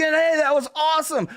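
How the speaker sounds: high-pitched excited imitation of a spectator; cheering crowd member. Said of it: "everyone was fucking clapping".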